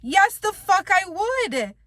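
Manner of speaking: loud, high, slow and fed up; emphatic retort. Real, not acted. Venting to viewers about an underpaying job, loud outraged retort. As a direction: spit it back, outraged. Angry reply to unfair job request.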